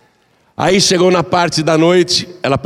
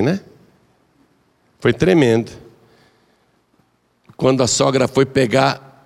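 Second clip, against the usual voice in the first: usual speaking voice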